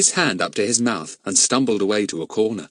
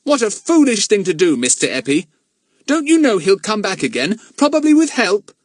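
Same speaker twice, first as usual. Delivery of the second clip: put-on much higher, louder boy's voice; boy Jack; 13 semitones higher, 6 dB louder than usual. Jack explaining himself to Mister Eppie, boyish protest. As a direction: answer as a high-voiced, defensive boy. Young Jack's character voice.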